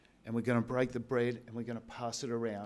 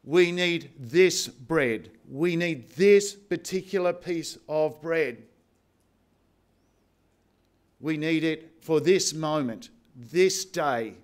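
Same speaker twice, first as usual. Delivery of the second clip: louder, slow, hitting this each time; emphasis on this; 2 semitones higher, 6 dB louder, slower than usual. Shown as a demonstration. Pastor shows the congregation the double emphasis, stressing this. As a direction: punch every this. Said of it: "The emphasis is either on the bread or on the moment".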